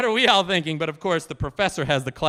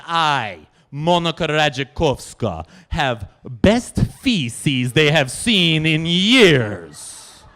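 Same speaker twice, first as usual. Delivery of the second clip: put-on slow, lower, louder Polish accent; slow Polish-accented pride, louder; boastful Polish lady; 3 semitones lower, 6 dB louder, slower than usual. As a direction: boast with great dignity. Monika boasts of professors' praise.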